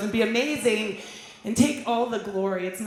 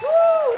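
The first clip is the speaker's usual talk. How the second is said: short, high, loud "woo"; excited whoop; 6 dB louder than usual. Real, not acted. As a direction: let out a high-pitched whoop to the crowd. Performer whoops between songs on stage.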